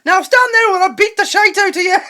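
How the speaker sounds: put-on a shouted, scolding Irish accent like a TV mum; hammed-up Irish accent, threatening. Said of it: "Don't know why that turned into an Irish accent".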